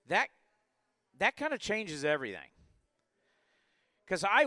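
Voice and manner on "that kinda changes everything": normal talking voice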